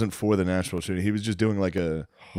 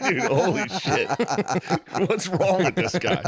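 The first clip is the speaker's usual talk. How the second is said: normal talking voice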